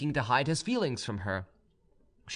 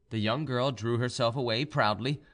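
plain reading voice

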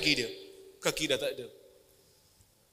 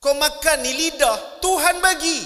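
louder, slower, each word driven home; raised rebuke; 8 semitones higher, 8 dB louder, slower than usual. Acted. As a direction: hammer the point at the crowd. (teguran lantang dan perlahan)

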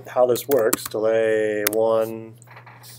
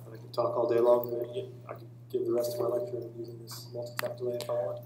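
faint repeating echo of the voice; delay echo; 16 dB quieter, faster than usual. Shown as a demonstration. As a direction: play it back as a distant echo.

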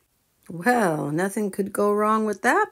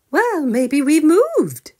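puzzled mother bear; 10 semitones higher, 6 dB louder, slower than usual. Acted. Missus Forgetful suggests.

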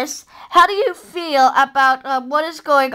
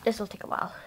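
ordinary talk, nothing marked

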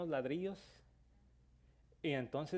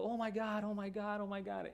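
high, fading mock panic; imitated panicked cry; 6 semitones higher than usual. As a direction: mimic the neighbor's frightened wail. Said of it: "Y estaba gritando". (grito de pánico imitado, agudo y que se apaga)

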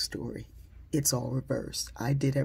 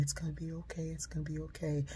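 soft, hushed comfort, much softer; soothing whisper; 3 semitones higher, 7 dB quieter, faster than usual. Retold or imitated. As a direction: rock a scared child with your voice. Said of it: "her saying to me".

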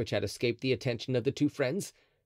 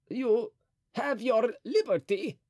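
terrified; 11 semitones higher, slower than usual. A narrator's reading. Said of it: "repeated Mazarin in terror".

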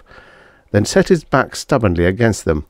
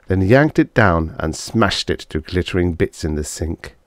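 ordinary delivery

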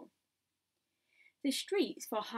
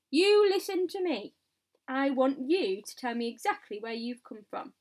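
raised voice, easing after the first order; commanding shout; 3 semitones higher, 7 dB louder than usual. A narrator's reading. Police chief questioning the travellers above, commanding shout. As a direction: demand an answer loudly. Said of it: "shouted the chief of police".

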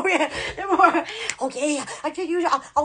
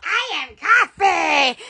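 ordinary delivery

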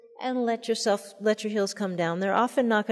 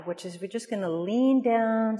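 ordinary talk

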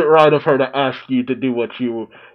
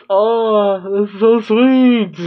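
touched; 7 semitones higher, 3 dB louder, much slower than usual. Real, not acted. Touched by sweet scene.

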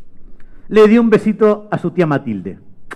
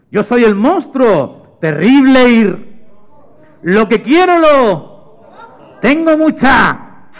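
loud, very slow, higher monster voice; giant monster; 6 semitones higher, 5 dB louder, much slower than usual. Acted. Monster leading children to repeat, loud slow menace. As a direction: boom out each phrase for an echo. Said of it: "volvió a salir aquel monstruo".

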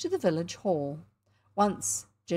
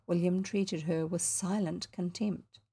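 plain reading voice

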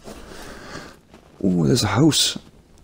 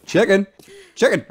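calling chickens; 7 semitones higher than usual. Real, not acted.

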